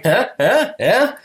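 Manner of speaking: short, high rising yelps; high-pitched, questioning mock jabs; mock taunting ex. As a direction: imitate the shrill ex goading everyone. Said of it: "starts going".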